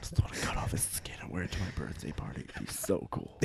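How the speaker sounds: unvoiced creepy whisper. Jokes about wearing Zac's skin.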